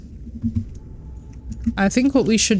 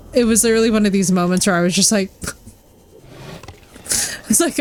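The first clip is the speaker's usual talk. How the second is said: normal talking voice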